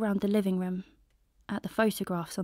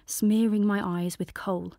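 ordinary delivery

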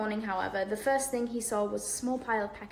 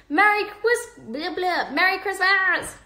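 much higher, loud shouted holiday cheer; boisterous greeting; 9 semitones higher, 8 dB louder, slower than usual. A narrator's reading. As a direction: burst in yelling the greeting. Twins bursting in, shouting greeting.

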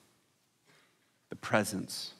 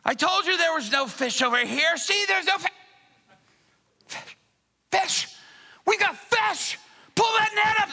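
building excitement; 11 semitones higher than usual. Acted. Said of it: "And he's like".

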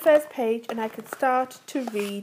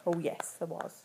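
hushed reply; 7 semitones lower, 15 dB quieter than usual. Acted.

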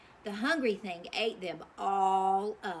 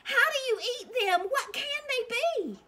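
much higher, fast astonished squeal; excited puzzled cry; 6 dB louder than usual. Acted. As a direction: shriek out in baffled wonder. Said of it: "cried the townspeople".

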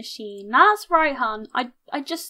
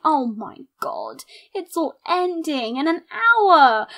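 ordinary delivery

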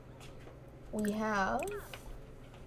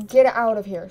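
loud shout; 9 dB louder than usual. Acted. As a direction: yell them out. Shouting at Sim.

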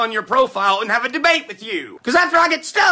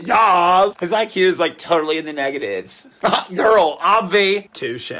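gushing valley-girl gossip, lightly voiced; a hint of a valley girl; 6 semitones lower than usual.

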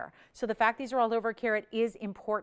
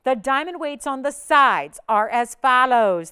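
ordinary talk

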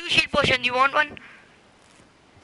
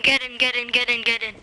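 usual speaking voice

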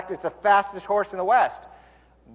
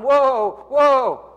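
calling whoa; 8 semitones higher, 5 dB louder than usual. Retold or imitated. Cowboy trying to stop horse.

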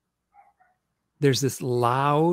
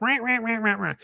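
high rah rah scolding sounds; mock scolding noise; 8 semitones higher than usual. The owl yelling at the man, high mock rant. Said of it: "like, chewing him out".

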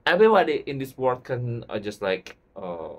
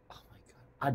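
hushed, voiceless amazement; awed whisper; 12 dB quieter than usual. Real, not acted. Fan lost for words over [name], whispering in awe. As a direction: whisper it, overwhelmed.

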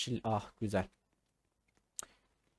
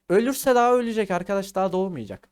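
1 excited joking shout; 5 semitones higher, 6 dB louder, faster than usual. Real, not acted. Excited joke about dying.

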